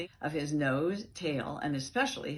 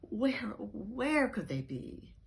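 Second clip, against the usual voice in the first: slow, higher anxious question; worried; 7 semitones higher, slower than usual. Acted. Dog searching frantically for lost kittens, worried. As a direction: fret aloud.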